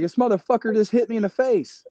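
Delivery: high, heated mock yell; retold outraged yell. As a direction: relive the moment he got hit, pitch way up. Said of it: "I'm like".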